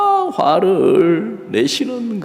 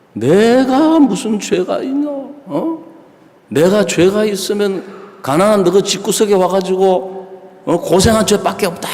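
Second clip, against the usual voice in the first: higher-pitched, indignant retold outburst; imitating angry mother; 6 semitones higher, 3 dB louder than usual. Mother protesting to her son that she is guiltless, imitated anger. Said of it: "어머니가 막 화를 빡 내시면서".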